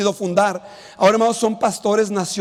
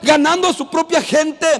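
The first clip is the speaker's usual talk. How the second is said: raised, ringing pulpit voice; fervent preaching; 4 semitones higher than usual. Acted. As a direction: proclaim it with joy to the church. Exultant preaching to congregation. (predicación fervorosa y exultante)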